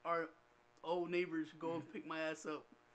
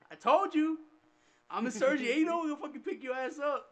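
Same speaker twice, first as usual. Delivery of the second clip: mock angry mom, higher and louder; retold scolding; 8 semitones higher, 7 dB louder than usual. Mother refusing to pick up her son, mock scolding. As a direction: imitate a fed-up mother telling off her kid.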